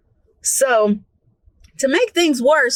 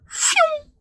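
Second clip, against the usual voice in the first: whoosh noise; 4 dB quieter than usual. Retold or imitated. Roach speed sound.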